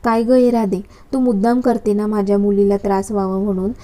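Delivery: usual speaking voice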